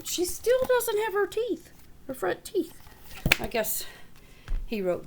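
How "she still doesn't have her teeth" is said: usual speaking voice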